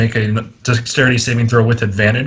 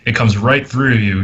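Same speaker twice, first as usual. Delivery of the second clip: ordinary talk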